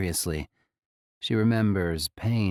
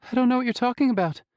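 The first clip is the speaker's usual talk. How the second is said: frightened denial; 3 dB louder, much faster than usual. A narrator's reading. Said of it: "Toshio says".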